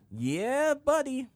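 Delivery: high, loud triumphant whoop; hype cheer. Real, not acted. Celebrating the game news with a friend, loud hype cheer. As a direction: cheer it like a bro hype-man.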